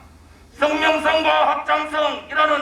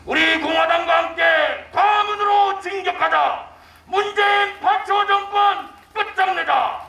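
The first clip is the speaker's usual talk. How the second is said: roaring rallying speech; 4 semitones higher than usual. Real, not acted. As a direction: rouse the crowd to march. Closing rally cries.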